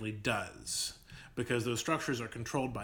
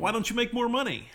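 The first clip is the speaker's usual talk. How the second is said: high, louder condescending mock question; mock condescension; 11 semitones higher, 5 dB louder than usual. Host parodying someone lecturing poor people, mock condescension. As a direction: parody a clueless scold, pitched way up. Mocking those who punch down.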